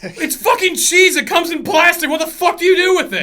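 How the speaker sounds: loud, high exasperated yelling; hammed-up furious outburst; outraged. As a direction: shout it in appalled disbelief. Acted argument over plastic in oven.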